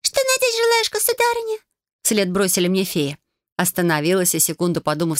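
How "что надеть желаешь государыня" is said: very high tinkling fairy voice; tiny fairy voice. Acted. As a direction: squeak it like a little fairy. Fairies call after her.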